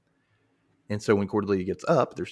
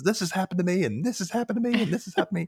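mock whiny complaint; 9 semitones higher, faster than usual. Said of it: "She's even saying".

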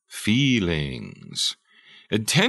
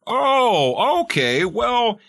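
mock accepting; 12 semitones higher, 4 dB louder than usual. Mock accepting parent reply.

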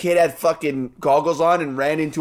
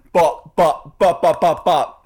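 mock beat noise; 2 semitones lower, 3 dB louder, slower than usual. Imitating TikTok noise.

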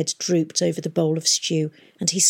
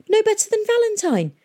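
"no better than Valentine" thrown out high and loud, falling hard at the end; angry; 4 dB louder than usual. Acted. Tom furiously compares Miss Fang to Valentine, "no better than Valentine" said high and loud. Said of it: "he said angrily".

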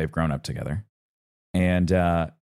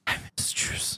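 imitating a sleepy kid's mumbled whisper; 10 dB quieter, slower than usual. Retelling his son's bedtime words, whispered mumble. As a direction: breathe it out like a sad toddler at bedtime. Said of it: "And he mumbles".

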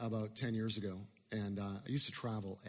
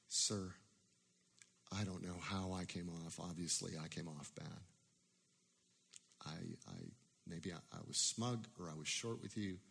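humble apology; 7 semitones lower, 9 dB quieter than usual. Retold or imitated. Humbly apologizing to angry man.

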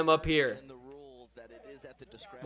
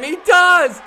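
big delighted shout, much higher and louder; excited shout; 14 semitones higher, 14 dB louder than usual. Real, not acted. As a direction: erupt at the winning point. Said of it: "calling the winning point".

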